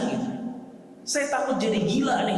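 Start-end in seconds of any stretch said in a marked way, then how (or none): 1.1-2.4 high, mildly panicked retelling; imitated fear. The Prophet confiding in his wife, acted fear. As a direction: act out a worried confession, voice raised in pitch. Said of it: "Rasul takut ketakutan luar biasa".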